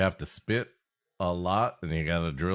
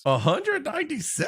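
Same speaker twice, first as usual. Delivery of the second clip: high incredulous question; much higher, stunned; shocked disbelief. Real, not acted. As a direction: ask it in high-pitched shock. Shocked at death toll.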